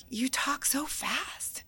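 slow, whispered imitation of a Louisianan; a soft, slow mock of a Southerner; mock whispered remark. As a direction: breathe it slowly like a drawling local. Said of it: "people would be like".

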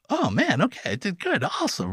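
hammed-up pleased squeak; mock self-congratulation. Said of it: "And I'm like".

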